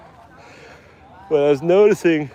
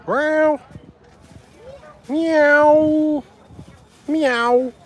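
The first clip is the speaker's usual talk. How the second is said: high mock meow; meowing at cats; much slower than usual. Calling to the cats with a high meow. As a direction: do a squeaky cat meow.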